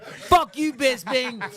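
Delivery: high, loud mock heckle; imitated shout of abuse; mock heckler. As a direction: yell it like a street heckler, half laughing. Retold heckling from pizza guys.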